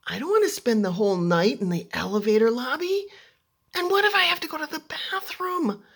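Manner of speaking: high, loud acted wail; tearful panic, re-enacted. Crying girl stranded in the elevator lobby, panicked wail. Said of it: "And then I started to cry".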